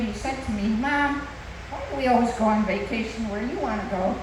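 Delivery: normal talking voice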